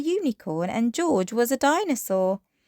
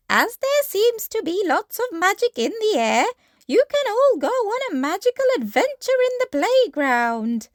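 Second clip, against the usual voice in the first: high teacher voice; Madame Gazelle; 11 semitones higher, 4 dB louder than usual. Acted. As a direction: announce the adventure to the children.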